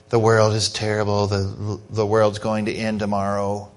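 low, soft mopey moan; gloomy Eeyore. Retold or imitated. Preacher mocking pessimists as Eeyore, low and mopey. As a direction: sigh it like a gloomy donkey. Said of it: "you know how Eeyore's".

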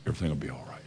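the still small voice in the heart; soft inner voice. Retold or imitated. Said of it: "When I hear that".

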